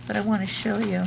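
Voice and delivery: usual speaking voice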